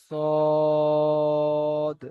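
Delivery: long, drawn-out "সদ" with rounded lips; rounded mouth. Shown as a demonstration. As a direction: hold "সদ" long and full on a round O. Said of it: "এর মুখ গোল করে বলতে হবে". (মুখ গোল করে টেনে "সদ")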